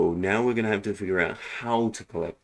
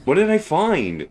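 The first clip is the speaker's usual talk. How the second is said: surprised; 11 semitones higher, 7 dB louder than usual. Real, not acted. Unexpected item appears.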